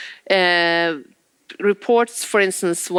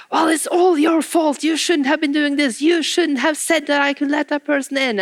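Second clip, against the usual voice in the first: mock blaming; 8 semitones higher than usual. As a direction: point the finger at your coworker. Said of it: "others will be like".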